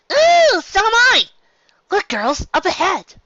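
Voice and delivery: very high, loud and giddy; high, bouncy, bursting with glee; bubbly excitement. Acted. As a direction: squeal it like an excited little pony. Excited pony feels the rain.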